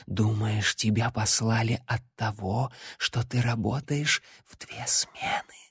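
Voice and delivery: soft eerie whisper; eldritch horror. Acted. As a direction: coax him like creeping darkness. The shadow speaks to Larry.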